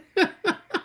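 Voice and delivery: a short, high laugh at her own joke. Real, not acted. Teacher laughing at her own joke to the chat, high and short.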